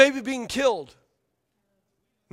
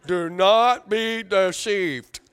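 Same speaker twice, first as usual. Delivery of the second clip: slow, drawn-out robot voice on "Do not be deceived"; mock a droning robot, every word stretched; robot imitation; 4 dB louder, much slower than usual. Preacher teasing his congregation's chanted response, mock robot voice. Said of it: "y'all sound like robots".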